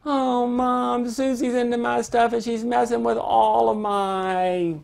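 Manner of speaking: put-on high whining kid's voice tattling to mom; child whining to mom about her sister; whiny child. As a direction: complain like a put-upon little kid. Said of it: "a child comes to you complaining".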